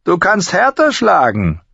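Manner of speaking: high-pitched, scornful goading; jeering. A narrator's reading. Master-at-arms urges Halder to hit harder, jeering. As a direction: goad the fighter with open scorn. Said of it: "höhnte Thorne".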